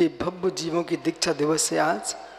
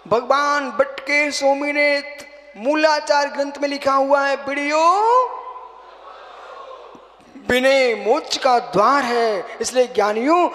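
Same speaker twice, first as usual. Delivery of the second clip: forceful preaching; 7 semitones higher, 6 dB louder than usual. Real, not acted. Monk teaches disciples the sutra on reverence, preaching forcefully. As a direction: proclaim the scripture to the whole assembly.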